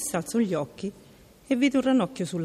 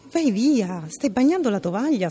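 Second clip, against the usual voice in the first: higher, louder irritated outburst; snapping; 5 semitones higher, 4 dB louder than usual. A narrator's reading. Princess snaps at the frog on the table, irritated. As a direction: snap at the frog. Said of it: "sbottò lei".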